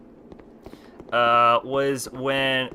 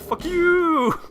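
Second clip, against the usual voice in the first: very high, drawn-out mock wail; overacted boss curse; 4 dB louder than usual. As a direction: let YOU soar up high and hang. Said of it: "cursing match with a boss fight".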